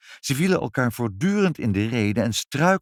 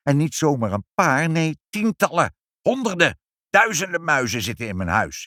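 climbing higher and louder with each number; escalating acted alarm; mounting despair; 8 semitones higher, 4 dB louder than usual. A narrator's reading. Inspector counts his mice for the parents, mounting alarm.

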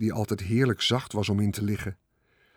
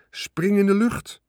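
high, loud call to jump; shouted command; 10 semitones higher, 4 dB louder than usual. A narrator's reading. Charlotte shouts to Wilbur to jump, loud command. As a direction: shout it across the pen. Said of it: "schreeuwde Charlotte".

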